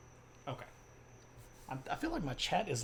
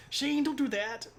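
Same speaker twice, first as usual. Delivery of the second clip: high mock scolding of a husband; playful, high-pitched wifely protest; impression of wife; 12 semitones higher than usual. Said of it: "done an impression of my wife before".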